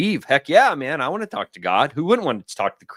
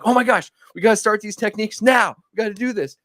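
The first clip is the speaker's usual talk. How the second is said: mock excited; 10 semitones higher than usual. Himself, swept up in enthusiasm.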